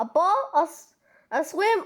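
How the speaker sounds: ordinary delivery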